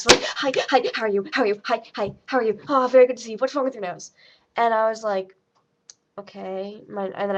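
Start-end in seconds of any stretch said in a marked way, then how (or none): 0.2-4.1 jittery, rapid-fire imitation; hyper doctor. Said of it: "like, ten Red Bulls". 6.2-6.8 soft, worn-out acted reply; tired reply. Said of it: "so I explained to him tiredly".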